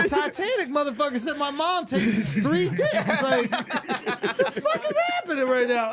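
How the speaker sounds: high, slow, laughing through it; laughing disbelief. Real, not acted. Comedian recalls Leo's joke, laughing high and slow. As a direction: crack up in disbelief.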